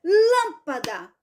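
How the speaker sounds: "Lâmpada" slowly, LÂM stretched and punched; teacher's slow demo of "Lâmpada", heavy on LÂM; stress on first syllable. Shown as a demonstration. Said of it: "Qual é a sílaba mais forte?".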